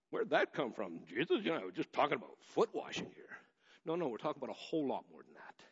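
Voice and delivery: ordinary talk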